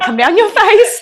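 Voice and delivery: high, loud, breaking into laughter; laughing through words. Real, not acted. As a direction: crack up on the punchline. Joking about tears, laughing.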